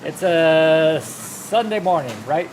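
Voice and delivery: loud, slow put-on announcer voice; a lightly hammed-up race host; mock announcer.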